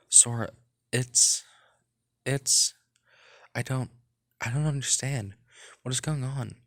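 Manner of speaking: hoger, hakkelend van schrik; shocked stammering. Acted. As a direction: struikel over je woorden van schrik. Shocked at the discovery.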